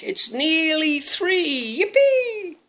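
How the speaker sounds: very high, loud gleeful witch squeal; hammed-up yippee; excited witch. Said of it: "she cried".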